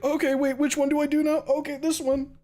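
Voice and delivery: high, hurried flustered voice; flustered self-quote. Retold or imitated. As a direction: play yourself scrambling through a list. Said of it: "be like".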